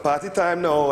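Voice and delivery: a light, cheerful Trinidadian sing-song; happy Trini voice. Retold or imitated. Imitating Trinidadians at soca parties, cheerful Trinidadian lilt. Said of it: "they talk like this".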